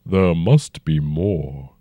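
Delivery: slow, rumbling ancient dragon. A narrator's reading. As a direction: speak as a huge old dragon, unhurried. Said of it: "the dragon said".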